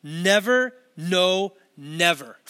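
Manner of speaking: NEVER, NO, NEVER, each punched separately; deliberate word-by-word hammering; emphatic. Shown as a demonstration. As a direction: hit Never loud, no just as hard, then drop on never. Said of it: "it's emphatic".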